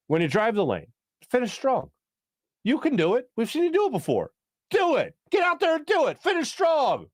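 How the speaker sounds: imitating a coach rallying a player; pep talk. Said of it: "we don't get to be like".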